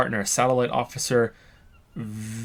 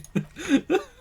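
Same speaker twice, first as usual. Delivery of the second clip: high, breathless giggling fit; can't stop laughing; real helpless laughter. Real, not acted. Player cracks up at game.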